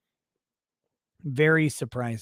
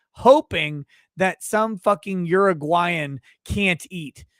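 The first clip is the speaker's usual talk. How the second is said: ordinary talk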